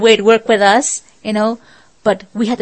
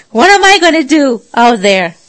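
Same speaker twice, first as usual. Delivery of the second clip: mock anxious believer; 3 semitones higher, 7 dB louder than usual. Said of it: "Or maybe in your case".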